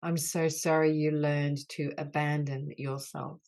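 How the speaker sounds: healing litany. Acted. Leader voicing apologies to the wounded inner child, earnest and grave. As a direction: lead the group through each sorry with tender gravity.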